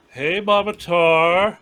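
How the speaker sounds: loud, slow call to the corpse. Acted. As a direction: hail the dead woman loudly. Calling to the dead body.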